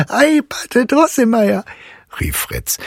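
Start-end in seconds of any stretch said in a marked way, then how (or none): 0.1-1.6 put-on high, merry boy's exclamation; amused boy calling. A narrator's reading. Fritz hails his funny godfather, high and amused. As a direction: call out high, full of amusement. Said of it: "mit lautem Gelächter unterbrochen hätte".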